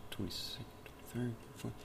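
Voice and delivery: barely audible whispered counting; muttering under breath. Real, not acted. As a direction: count it off to yourself, almost silent. Counting centuries under his breath.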